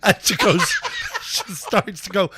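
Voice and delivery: loud, high telling through laughter; laughing hard. Real, not acted. Storyteller nearing the punchline, real loud laughter. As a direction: push the story out while cracking up.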